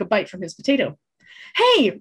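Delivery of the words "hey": high loud angry snap; snarl. Acted. Boy guarding his potato, angry snap. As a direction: snap at the thief. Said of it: "Reuben snarled".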